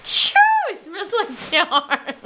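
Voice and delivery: drawn-out imitation Southern accent, laughing; attempted Southern accent. Shown as a demonstration. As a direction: copy the Southern twang, breaking into laughter. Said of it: "you say it and I repeat it".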